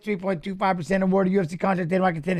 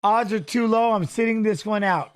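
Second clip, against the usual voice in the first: normal talking voice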